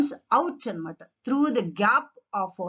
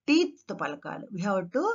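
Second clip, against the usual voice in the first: usual speaking voice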